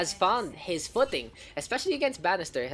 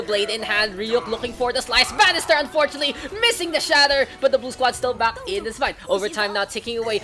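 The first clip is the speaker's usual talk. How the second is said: fast, louder, higher call; fast hyped call; 6 semitones higher, 6 dB louder than usual. Acted. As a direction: rattle off the miss.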